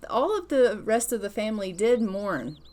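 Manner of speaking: usual speaking voice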